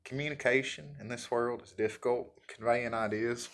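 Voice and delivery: hillbilly accent. Shown as a demonstration. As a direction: lay on a hillbilly accent for fun. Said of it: "my hillbilly talk".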